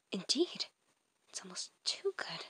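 hushed, secretive whisper. Acted. A comic character privately admits his awe in a whisper. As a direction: lean in and whisper it.

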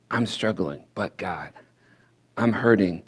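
usual speaking voice

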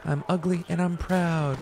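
high, slow cartoon proclamation; SpongeBob. Streamer quoting a cartoon about his looks, high proud impression. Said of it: "As SpongeBob once said".

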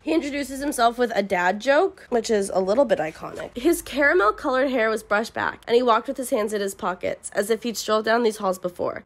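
ordinary delivery

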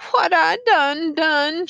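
high, slow, loud lament; acted wail; crying out. A narrator's reading. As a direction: cry it out as an anguished question. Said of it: "she cried".

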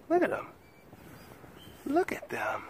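very high squeal. Real, not acted. Owner squealing at her pets, high delighted squeak.

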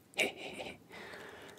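soft, voiceless mean snicker; whispered gloating chuckle. Acted. Bully mocks the girl's ruined rice balls, whispered chuckle. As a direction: snicker cruelly under your breath.

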